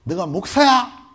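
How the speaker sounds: loud, high and slow menace; captor's challenge. Retold or imitated. Gunman confronting the pastor, loud, slow and menacing. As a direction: drag it out like a threat. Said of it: "얘기하는 거예요".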